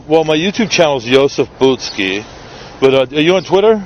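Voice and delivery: ordinary talk, nothing marked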